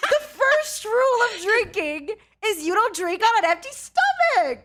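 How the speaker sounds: loud, high-pitched outraged lecture; exasperated mom-style shouting; mock scolding. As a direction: scold a friend at the top of your voice. Mock-scolding friend for drinking unfed.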